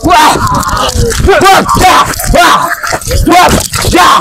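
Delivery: fight cry. Acted.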